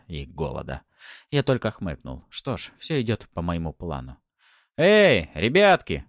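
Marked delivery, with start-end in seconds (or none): 4.8-6.1 loud, high, drawn-out hail; calling out. A narrator's reading. Hero calling to the bandits, shouted. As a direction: holler to get their attention. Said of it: "крикнул бандитам".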